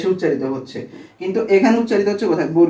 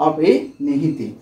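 slow term; 3 semitones lower, much slower than usual. Shown as a demonstration. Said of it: "এজন্য এটাকে বলা হয়".

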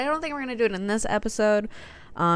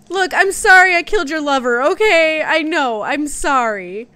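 mock apology; 7 semitones higher, 8 dB louder than usual. Real, not acted. Taunting enemy mid-fight.

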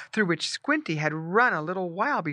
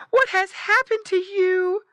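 high, loud pitying cry; wailing mama pig sympathy; distressed mother pig; 7 dB louder than usual. Acted. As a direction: fuss over your piglet in a high voice. Mother pig finds hurt piglet.

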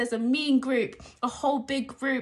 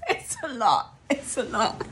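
laughing. Real, not acted. Laughs at own reaction.

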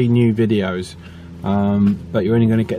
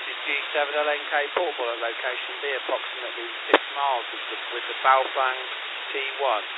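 much softer, through a radio speaker; tinny radio playback; over the radio; 7 dB quieter, faster than usual. Shown as a demonstration. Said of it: "we'll try it again".

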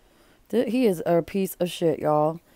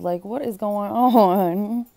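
slow, drawn-out disbelief; exasperated; 2 semitones higher, 5 dB louder, much slower than usual. Real, not acted. Host reacting to the husband's scheming, slow exasperated disbelief. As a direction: stretch it out, fed up and baffled.

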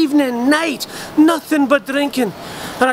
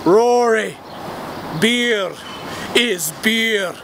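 deep heavenly voice; 3 semitones lower, slower than usual. Retold or imitated. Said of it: "a voice as deep as the ocean".

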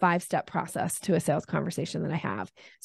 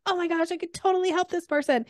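much higher, overeager sales gush; dog calling energy; 10 semitones higher than usual. Retold or imitated. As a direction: blurt it out too keen. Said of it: "show up with dog calling energy".

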